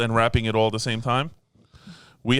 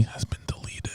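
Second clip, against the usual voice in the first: whispering; 11 dB quieter, slower than usual. Real, not acted. Whispered aside.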